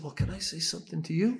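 pointed comeback. Retold or imitated. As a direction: throw the question back with rising pitch. Throws official's question back at him.

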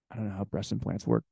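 ordinary delivery

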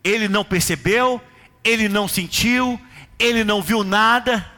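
ordinary delivery

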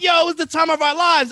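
high, excited retold cheer; mock hyped friends. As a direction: imitate friends buzzing after a great party. Imitates friends coming out of club.

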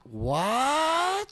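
high rising stunned what; disbelieving what. Retold or imitated. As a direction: pitch it way up in disbelief. Disbelief at the world.

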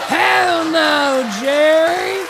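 high-pitched imitation holler; mock emphatic refusal. Host parroting the guest to Jerry, mock hollering.

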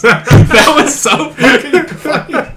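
high, slow words through laughter; can barely speak for laughing; laughing hard. Real, not acted. Laughing at favorite joke.

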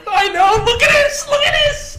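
high-pitched gleeful squeal, half laughing; giddy showing off. Retold or imitated. Joyriders at the boat's wheel showing off, giddy. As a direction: imitate a reckless kid at the wheel. Retold goofing while steering the boat.

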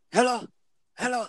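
high, odd put-on greeting; mock weird stranger. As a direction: imitate the strange caller. Imitating weird Ugandan guy.

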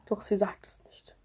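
a hushed whisper. Acted. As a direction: breathe it out as a whisper. Resigned aside. (geflüstert)

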